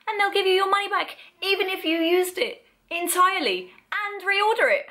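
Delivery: excited. Real, not acted. Excited about QVC refunds.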